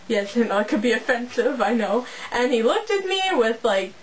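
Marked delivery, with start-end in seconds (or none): none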